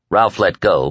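usual speaking voice

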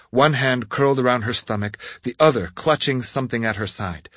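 usual speaking voice

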